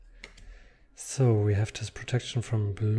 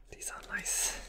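hushed unvoiced whisper; 14 dB quieter than usual. Real, not acted. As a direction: whisper it like a secret. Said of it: "Why am I whispering?".

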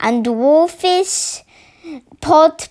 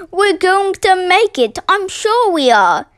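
higher-pitched, rallying fox; rally the family, pitched up; hopeful encouragement; 5 semitones higher than usual. A narrator's reading. Mr Fox as the noises grow fainter, rallying hopefully.

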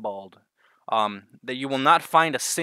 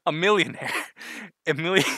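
real giggles interrupting the words; failing to hold back a laugh; laughing too much; 2 semitones higher, 4 dB quieter, faster than usual. Real, not acted. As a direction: keep breaking into laughter mid-phrase. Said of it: "I'm laughing way too much".

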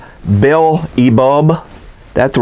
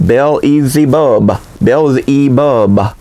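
drawn-out summoning; much slower than usual. Acted. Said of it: "We need to talk".

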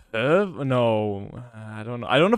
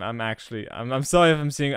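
ordinary delivery

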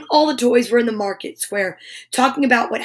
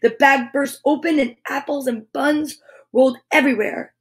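ordinary delivery, nothing marked

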